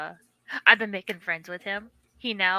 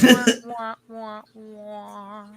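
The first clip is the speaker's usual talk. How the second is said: mouth-made sad trombone wah wah; sad trombone sound; 3 dB louder, much slower than usual. Pretend. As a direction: droop the wahs like a losing horn. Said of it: "my sad trombone sound".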